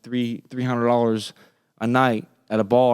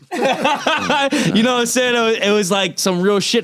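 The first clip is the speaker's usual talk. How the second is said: higher, slow laughing-through words; 9 semitones higher, 3 dB louder than usual. Real, not acted. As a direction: talk while still chuckling at it. Laughing after recalling the rebuke.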